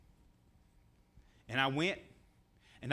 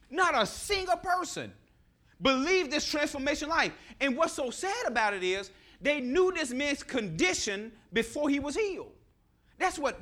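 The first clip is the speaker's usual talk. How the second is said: rising sermon; 5 semitones higher than usual. Acted. Preacher builds on the story.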